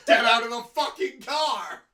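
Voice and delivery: mock-threatening yell; mock carjacker shout. Friend acting out a modern carjacking, mock shout.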